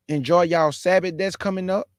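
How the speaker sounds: usual speaking voice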